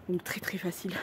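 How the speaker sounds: soft whispered aside. Real, not acted. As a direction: drop to a whisper.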